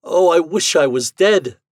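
loud, high wail of despair; anguished cry. Acted. Husband left by his wife, crying out in despair. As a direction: cry it out in misery. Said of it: "I cried".

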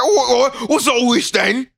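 high, slow, shouted Cockney; rough London slang. Retold or imitated. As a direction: bellow it like a London bobby. Mocking British characters.

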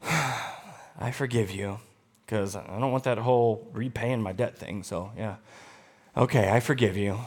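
sighing, low, soft and deflated; grudging forgiveness. Acted. Believer forgiving only from duty, grudging. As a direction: forgive as if it were a chore. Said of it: "if your forgiveness is just going".

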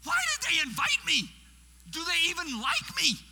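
high, loud, indignant questioning; hammed-up bewildered protest; exasperated complaint. Guest speaker alone in the room wondering why invited, exasperated shout.

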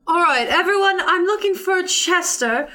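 calling out. Acted. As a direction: call out over the market to the whole crowd. Calling out to the crowd.

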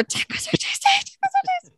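very high, squeaky panicked imitation; high-pitched mock-frantic whisper into an earpiece. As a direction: squeak out the signal in a hammed-up panic.

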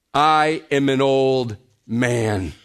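put-on "I am an old man" slow and weighty, leaning on I; emphatic statement. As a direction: stretch out I, then land firmly on man. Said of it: "That's a very emphatic statement".

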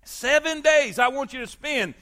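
emphatic pulpit delivery, hammering repeated phrases; impassioned preaching. Acted. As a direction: proclaim God's instructions with rising force. Preaches with raised voice.

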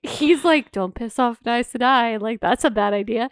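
ordinary delivery

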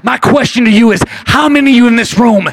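loud, ringing pulpit voice; preaching at full force. Acted. Preacher stirring the congregation, loud and fervent. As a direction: preach it out big to the room.

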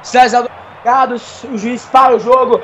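ordinary talk

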